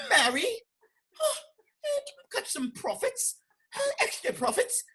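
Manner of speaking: high, slow hammed-up British voice, laughing through it; a laughing, over-the-top London accent; mock British accent. Redoing the British accent, high and laughing. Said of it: "I messed up the whole accents".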